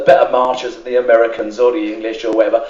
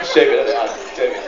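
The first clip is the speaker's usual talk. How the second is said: ordinary delivery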